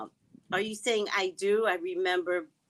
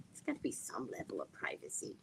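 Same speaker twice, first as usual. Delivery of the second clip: lower, hushed whisper; whispered aside; 12 semitones lower, 14 dB quieter than usual. Real, not acted. Telling viewers about privacy, whispered aside.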